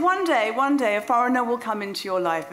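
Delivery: loud, high proclamation; psychic's prophecy. Retold or imitated. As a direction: proclaim it like a fortune teller foretelling fate. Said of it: "But she said".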